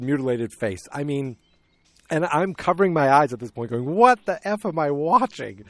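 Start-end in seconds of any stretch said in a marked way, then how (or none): 3.8-5.6 louder, higher appalled outburst; appalled disbelief. Retold or imitated. Viewer covering eyes at the gore, appalled outburst. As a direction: exclaim in hammed-up horror. Retells own horrified viewing reaction.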